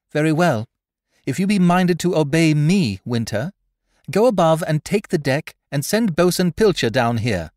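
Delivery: high, loud, sudden command; voice leaping out. A narrator's reading. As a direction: snap out orders to the crew. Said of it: "Then her voice leaped out at them".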